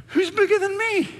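very high-pitched mock disbelief; comically baffled question, voice way up; incredulous God. God asking the angels, comically incredulous.

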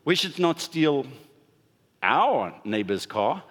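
"our" lifted with a rise after a pause, slow; stress on our. Shown as a demonstration. Preacher twisting his own line, pushing "our". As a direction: make "our" the point, as if other cars are fair game. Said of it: "you can highlight where you feel like the accent".

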